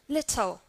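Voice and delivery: "Little" with a clearly released T; British T. Shown as a demonstration. Teacher repeats the second example, British T. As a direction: keep a real T in "Little". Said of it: "The second one".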